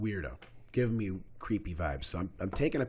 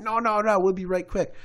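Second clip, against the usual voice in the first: higher, louder mock insistence; pitched-up imitation of brushing someone off; imitating the women; 9 semitones higher, 5 dB louder than usual. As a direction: mimic their hurried false reassurance. Mimics women insisting they'll return.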